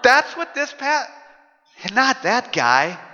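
drawn-out questioning objection, rising at the end; mock protest. Preacher voices reluctance to love the obnoxious neighbor, mock protest. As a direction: balk at it, slowly, ending on a rise.

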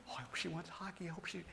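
hushed hopeful aside to himself; hushed inner hope. Retold or imitated. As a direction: whisper the wish to yourself. Husband's hopeful inner thought.

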